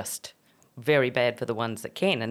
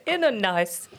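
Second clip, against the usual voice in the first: laughing; 4 semitones higher, slower than usual. Pretend. Repeating the pirate line laughing.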